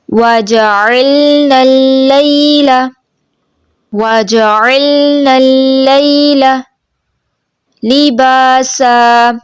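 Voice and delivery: slow melodic tajweed chant; Quran recitation. Shown as a demonstration. Teacher modelling the verse for the student in slow chant. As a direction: recite slowly, drawing out each vowel.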